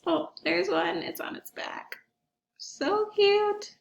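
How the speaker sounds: high squeal over a cute otter; delighted squeal. Real, not acted. Teacher spots a sea otter on the webcam, squealing with delight. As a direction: gush over the otter on screen.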